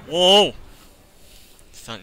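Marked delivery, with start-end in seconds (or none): 0.1-0.5 loud, high "whoa"; a loud, high-pitched cry of alarm; startled shout. Real, not acted. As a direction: yell out in sudden surprise. Startled shout during gameplay.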